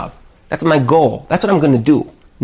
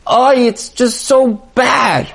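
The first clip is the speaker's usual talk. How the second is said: slow, drawn-out mock moan; a light moaning complaint; mock kvetching; 5 semitones higher, slower than usual. Mimicking emailers who complain about bad marriages, slow mock moan. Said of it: "who they say".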